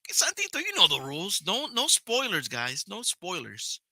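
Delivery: high, squeaky mock scolding of a friend. Streamer teasing Santito about spoilers, squeaky mock scold. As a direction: wag a finger in a silly high voice. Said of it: "It's like".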